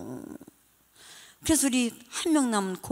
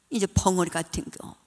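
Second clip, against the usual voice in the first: normal talking voice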